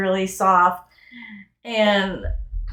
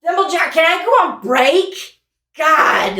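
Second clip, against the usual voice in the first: fed-up doll; 7 semitones higher, 4 dB louder than usual. Acted. Bored doll asking for a break.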